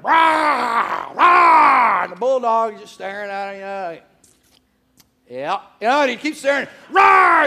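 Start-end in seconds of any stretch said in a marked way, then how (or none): 0.0-2.0 loud, raised imitation tiger roars. Mike the Tiger roaring at the bulldog, tiger roar. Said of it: "he's roaring". 6.9-7.5 loud, high imitation roar; tiger roar. Mike roaring as the bulldog keeps staring, tiger roar. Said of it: "The louder Mike roared".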